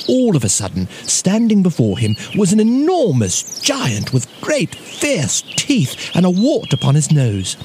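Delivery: usual speaking voice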